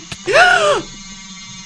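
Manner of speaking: huge high gasp of terror; loud shocked gasp. Real, not acted. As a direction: gasp like being grabbed. Monster attacks.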